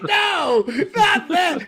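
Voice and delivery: high, loud, laughing mock shout; mock protest. Comedian rejecting Theo's dad impression, mock outraged shout. Retold outraged reaction in skit.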